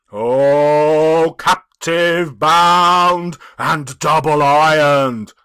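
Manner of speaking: loud, very slow ghostly cry. A narrator's reading. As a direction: wail it out as a doomed spirit. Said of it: "cried the phantom".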